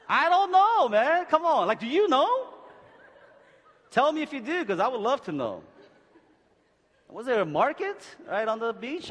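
high and loud, laughing through the words. Real, not acted. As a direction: laugh while begging them to tell you. Laughing plea to the congregation.

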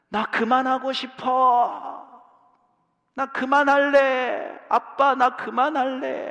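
high, slow, loud pleading cry; a child wailing to make it stop; crying child's plea. Retold or imitated. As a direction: beg to stop like a sobbing child. Son crying during stitches.

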